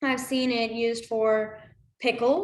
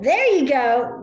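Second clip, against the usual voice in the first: encouraging cheer; 3 semitones higher, 8 dB louder than usual. Real, not acted. Cheering a viewer's sign.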